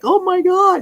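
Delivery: high, loud panicked gasp of a tall man; flipped-out man. Retold or imitated. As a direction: mimic his shocked alarm. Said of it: "the guy was flipped out".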